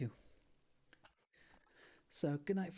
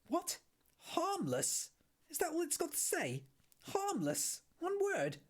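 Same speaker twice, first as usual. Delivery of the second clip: high, incredulous outburst, much higher pitched; outraged disbelief; 3 dB louder, slower than usual. Acted. Arthur outraged at the Guide's entry, high indignant disbelief. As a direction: Arthur can't believe his planet got one word. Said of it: "Then his mind nearly blew up".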